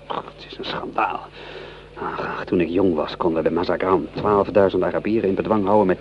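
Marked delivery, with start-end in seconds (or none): 0.1-2.5 breathy, soft muttered disgust. Acted. Lieutenant muttering in disgust over the confused orders. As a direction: mutter it under your breath.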